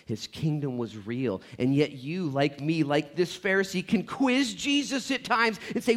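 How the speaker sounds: ordinary delivery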